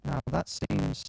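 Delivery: glitchy robotic audio distortion. Real, not acted. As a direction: sound like a broken robot interface. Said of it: "He's gone Robo".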